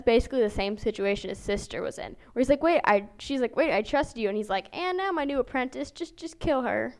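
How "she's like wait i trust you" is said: usual speaking voice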